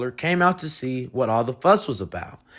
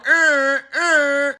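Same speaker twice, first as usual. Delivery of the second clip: high honking sound; car horn; 9 semitones higher, 7 dB louder than usual. Acted.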